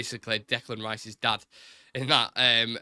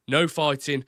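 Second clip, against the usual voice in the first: Brummie accent, raised and firm; 3 semitones higher, 5 dB louder, slower than usual. Shown as a demonstration. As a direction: repeat "No fighting" in Brummie. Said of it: "I could do Brummie as well".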